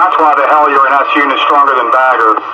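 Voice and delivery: normal talking voice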